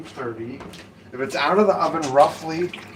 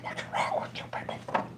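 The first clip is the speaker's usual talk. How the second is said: soft unvoiced exasperated mutter; whispered aside; 11 dB quieter, faster than usual. Real, not acted. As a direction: whisper it under your breath.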